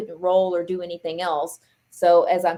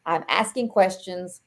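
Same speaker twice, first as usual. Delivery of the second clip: ordinary talk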